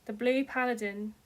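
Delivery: usual speaking voice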